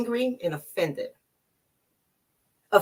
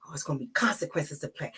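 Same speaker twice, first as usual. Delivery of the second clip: a fast, hushed whisper of menace; whispered threat; 6 semitones lower, 9 dB quieter, faster than usual. Retold or imitated. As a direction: breathe the warning fast under your breath. Muttered threat under her breath.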